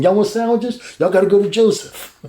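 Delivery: high, bright mock guard boasting; lightly voiced cheery guard; playful guard voice. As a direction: play the guard bragging about his buddy. Guard voiced bragging about Joseph.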